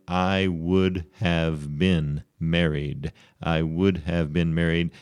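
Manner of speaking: slow, fully sounded "would have been"; careful teacher's full-form demo; full uncontracted form. Shown as a demonstration. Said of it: "instead of saying".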